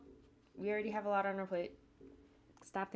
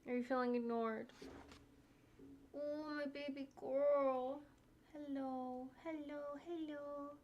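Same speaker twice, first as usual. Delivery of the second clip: sing-song pet voice, pitched up; cooing to dog; 7 semitones higher, slower than usual. Real, not acted. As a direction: fuss over a dog who feels ignored.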